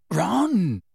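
croaked. A narrator's reading. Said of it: "croaked Harry".

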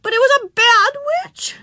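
put-on very high, loud little-girl wail; upset child's shrill complaint; tearful accusation. A narrator's reading. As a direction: tattle indignantly to the teacher. Tearful Susan accuses the witch.

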